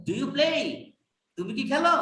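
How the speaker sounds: "Do you play?" lifts up on "play"; rising yes-no question. Shown as a demonstration. Teacher models the yes-no question, rising tune. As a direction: let "Do you play?" and "তুমি কি খেলো?" climb at the end. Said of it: "তখন rising এ চলে যাবে".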